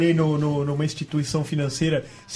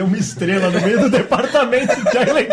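laughing; 4 semitones higher, 5 dB louder than usual. Real, not acted. Laughing while telling story.

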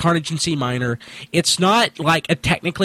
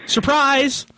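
surprise party shout; 9 semitones higher than usual. Pretend. Mock surprise party yell.

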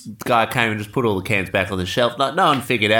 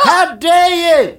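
outraged shriek; 7 dB louder, slower than usual. Acted. Can of corn outraged.

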